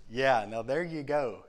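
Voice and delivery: loud, slow, emphatic approval; a booming, drawn-out "now there you go"; fired-up agreement. Retold or imitated. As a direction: cheer it like an amen. Agreeing, fired up about Jesus.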